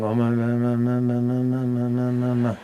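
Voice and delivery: "ma, ma, ma" run together in a soft mindless mumble; mumbled ma ma. Retold or imitated. Teacher mocking careless chanting, soft droning "ma". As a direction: drone "ma, ma, ma" with no end syllable. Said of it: "you shouldn't be like, uh, saying".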